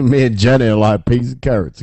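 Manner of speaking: lightly voiced Forrest Gump. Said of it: "I love [name]'s impressions".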